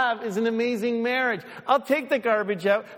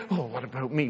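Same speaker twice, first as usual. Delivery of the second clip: lower, softer self-pitying whine; whiny kid; 6 semitones lower, 3 dB quieter, faster than usual. Retold or imitated. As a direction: sulk like a little kid. Whiny child voiced.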